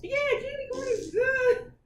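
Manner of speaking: very high, laughing through the words; laughing candy corn is good; laughing gloat. Real, not acted. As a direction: gloat while cracking up. Gloating agreement.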